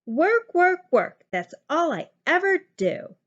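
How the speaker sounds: fed up with endless chores; grumpy complaint. A narrator's reading. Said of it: "Gertie grumped".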